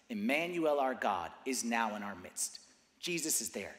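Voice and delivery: low, hushed, weighty delivery; solemn preaching. Real, not acted. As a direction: let each phrase land softly with long pauses. Solemn scripture and exhortation.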